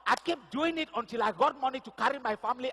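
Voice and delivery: high, loud triumphant preaching; preaching climax. Acted. Pastor ending his testimony, triumphant loud preaching. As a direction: proclaim the victory to the church.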